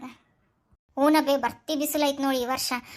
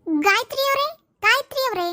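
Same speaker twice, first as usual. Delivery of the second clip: calling out; 7 semitones higher than usual. Acted. Calling neighbour from outside.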